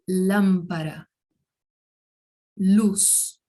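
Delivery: "lâmpada", "Luz" as separate careful Spanish models; Spanish L examples. Shown as a demonstration. Teacher giving examples of L before vowels, careful Spanish models. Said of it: "vai ter o mesmo som que em português".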